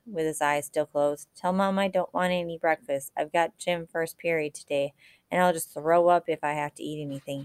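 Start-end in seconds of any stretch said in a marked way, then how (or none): none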